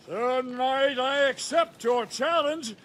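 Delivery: indignant dragon shout. Acted. Dragon answers knight in joke.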